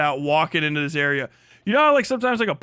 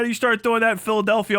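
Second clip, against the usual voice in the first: usual speaking voice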